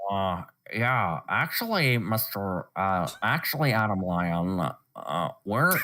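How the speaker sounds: low put-on cartoon character voice; Windsor voice. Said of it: "Do Windsor from Mighty Butters".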